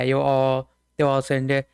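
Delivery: ordinary delivery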